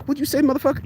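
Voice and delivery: high-pitched, put-on comeback; baited mock challenge. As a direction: jump up high as if taking the bait. Acted eavesdropper taking the bait.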